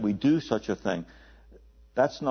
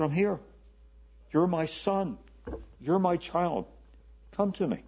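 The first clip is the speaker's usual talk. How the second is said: put-on higher, gentle voice of God calling a child; God's voice; 6 semitones higher than usual. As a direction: voice God tenderly, as a father inviting his son. Voicing God speaking to him.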